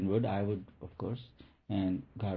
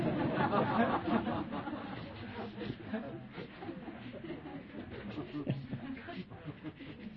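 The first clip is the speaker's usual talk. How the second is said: high laugh; 13 semitones higher, 8 dB quieter than usual. Real, not acted. Laughs at own joke.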